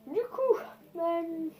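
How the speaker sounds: high-pitched imitation of his voice; imitating the passenger. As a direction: squeak it up like the guy on the bus. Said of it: "il parlait comme ça". (imite la voix aiguë du passager)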